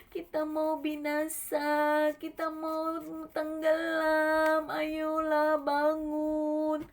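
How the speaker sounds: hammed-up sing-song begging; pleading disciple. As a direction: wail for rescue, dragging out every word. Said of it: "muridnya bilang begini".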